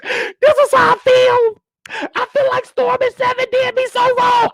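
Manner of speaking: high, slow, whiny mock sobbing; mock crying. Mocking YouTubers who cry on camera, whiny high wail. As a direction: ham up a weepy complaint, pitch way up. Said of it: "I didn't get on here crying like".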